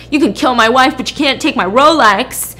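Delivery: loud mock-indignant husband; macho outrage. Husband refuses the loan shark his watch, mock macho outrage. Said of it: "this pisses off real John".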